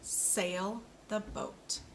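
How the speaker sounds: "Sail the boat" said very slowly, each word apart; slow model sentence. Shown as a demonstration. Speech therapist models a sentence for a child, slow and careful. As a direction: lay it out slowly for the learner. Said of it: "One more time".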